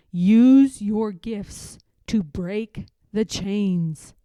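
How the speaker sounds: a steady divine order; voice of God. Acted. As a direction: send her back with authority. God commands her.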